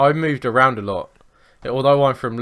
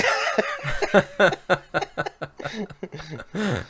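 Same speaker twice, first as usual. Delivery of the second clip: long high laugh dying away; high laughter that gradually winds down; 6 semitones higher than usual. Real, not acted. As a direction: laugh on until it peters out. Still laughing at the joke.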